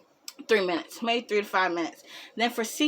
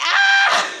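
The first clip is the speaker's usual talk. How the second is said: very high, loud comic scream; mock scream; 8 dB louder than usual. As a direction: shriek it as a joke. Retold reaction to the boyfriend.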